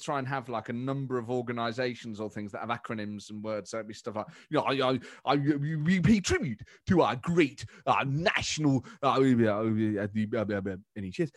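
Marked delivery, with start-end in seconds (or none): 4.5-11.3 Boris. Retold or imitated. Politician falling back on the letters, lost-word stammer. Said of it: "forgotten what he's gone in for".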